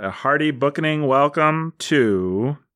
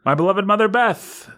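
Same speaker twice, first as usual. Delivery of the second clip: grand announcer; 7 semitones higher than usual. Acted. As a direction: present her like royalty. Hyping up each team name.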